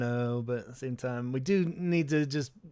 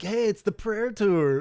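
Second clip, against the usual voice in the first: put-on high, slow excited kid; imitating the excited kids; mock kid voice; 8 semitones higher, 4 dB louder, slower than usual. Kids dragging dad to the tour, mock excited.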